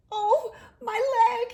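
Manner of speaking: high, loud pained wail; high loud cry of pain. A narrator's reading. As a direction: yelp in pain over a hurt leg. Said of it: "he cried".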